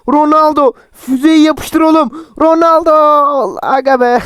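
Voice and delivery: high, drawn-out urging; will Ronaldo to shoot; excited cheer. Real, not acted. Urging Ronaldo to shoot, a high drawn-out cheer.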